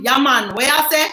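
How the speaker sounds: relaxed Jamaican patois, lightly voiced; easy yard talk in a Jamaican accent. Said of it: "you know how the Jamaicans them put on the twang? So you went from".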